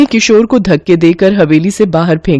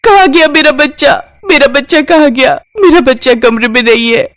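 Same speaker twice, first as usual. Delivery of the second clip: put-on much higher, frantic cry for her child; panicked screaming mother; 7 semitones higher than usual. Daughter-in-law runs out screaming for her missing child, panicked. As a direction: shriek in terror for the missing baby. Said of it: "चीखती हुई बाहर आती है".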